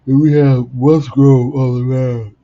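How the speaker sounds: sleepy yawn spilling into the lineup read; yawning while talking. Real, not acted. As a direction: stretch the words as a yawn takes over. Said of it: "if I yawn one more time".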